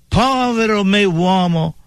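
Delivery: high, anguished cry of "Povero me uomo!". Retold or imitated. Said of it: "e gridò".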